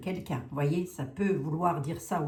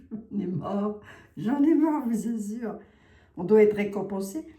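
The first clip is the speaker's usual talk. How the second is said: half-joking fed-up outburst, louder and higher; mock exasperation; 4 semitones higher, 5 dB louder than usual. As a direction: play at being fed up, pitch climbing. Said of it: "je reprends mon sérieux". (ras-le-bol pour rire, plus fort et plus aigu)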